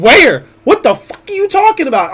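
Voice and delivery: high, loud mock disbelief; retold incredulous retort. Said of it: "I was like".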